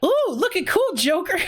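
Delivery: high-pitched cartoon mascot voice; very high, excited chibi voice; Morgana. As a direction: squeal it like a cute little pet character. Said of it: "do you know Morgana from".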